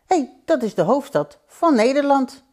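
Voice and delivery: put-on high, lively child's exclamation; little boy. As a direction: pipe up like a boy who knows this. Boy voiced, recognising the capital. (opgetogen jongetje, hoge stem)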